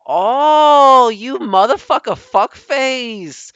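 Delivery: high, very loud drawn-out cursing yell; pissed off. Retold or imitated. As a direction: explode at the caller in mock fury. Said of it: "he gets, like, pissed".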